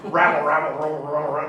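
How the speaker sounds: garbled cartoon cursing grumble; Yosemite Sam. Man who hit his thumb, cartoon mock-cursing mumble. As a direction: rattle off nonsense syllables as mock swearing. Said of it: "it's that old Yosemite Sam".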